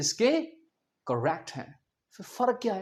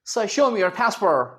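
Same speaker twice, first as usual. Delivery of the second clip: slow American airport officer; American English, "pæs-perr" with curled r; American accent; much slower than usual. Acted. US airport officer to arriving traveller, American accent. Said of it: "अक्सर वो क्या कहेंगे".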